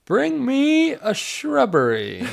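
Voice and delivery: very high, slow comic demand; mock-imperious, stretched and squeaky-high; Knights of Ni. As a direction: draw it out in a high silly knight voice.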